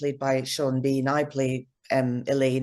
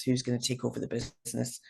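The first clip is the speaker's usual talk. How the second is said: dropped to a soft aside; quiet; 3 semitones lower, 9 dB quieter than usual. Real, not acted. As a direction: echo it softly, almost to yourself. Repeats the question, softer echo.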